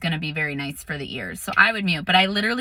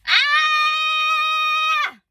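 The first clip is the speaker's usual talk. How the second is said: primal scream. Shown as a demonstration. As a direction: release everything in one scream. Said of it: "take a big deep breath and just".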